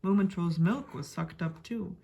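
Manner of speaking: ordinary delivery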